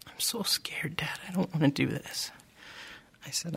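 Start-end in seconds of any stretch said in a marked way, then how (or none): 0.1-2.3 put-on frightened child's whisper, a little higher; scared whisper. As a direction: breathe it out, scared and small. Tears in his eyes.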